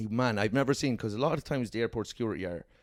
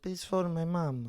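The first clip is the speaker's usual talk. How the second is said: slow, higher plea in a put-on Italian accent; 7 semitones higher, 3 dB quieter, slower than usual. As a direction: plead like an Italian mama's boy. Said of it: "like, an Italian accent".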